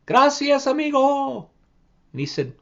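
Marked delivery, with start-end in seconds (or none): 0.0-1.5 high thankful chorus voice; grateful animals. Acted. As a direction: thank him brightly. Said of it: "they all said to Medio Pollito".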